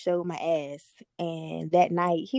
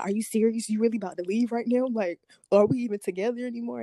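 retold disbelief; 3 semitones higher than usual. Girlfriend as boyfriend threatens to leave, retold disbelief. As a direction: imitate an upset girlfriend challenging him, pitch lifted.

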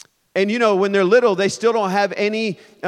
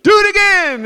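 put-on high, loud, thrilled child's cry; excited kid; 13 semitones higher, 8 dB louder than usual. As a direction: beg gleefully like a toddler. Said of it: "and he goes".

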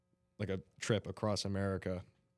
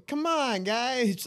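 much higher, louder pleading; influencer whine; 10 dB louder, much slower than usual. Retold or imitated. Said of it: "just goes".

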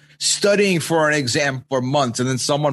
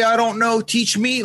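higher-pitched imitation of a helpless appeal; sympathetic pleading; 7 semitones higher than usual. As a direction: plead gently to be taught. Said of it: "Like, sympathetically say".

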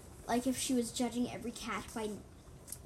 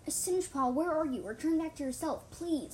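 raised, urgent plea, mildly acted; begging; 6 semitones higher, 6 dB louder than usual. A narrator's reading. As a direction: plead with someone to come back. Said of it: "I begged".

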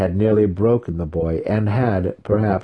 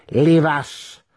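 curt command; 9 semitones higher, 4 dB louder than usual. Acted. Matron ordering daughters out.

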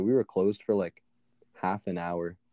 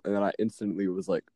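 ordinary talk, nothing marked

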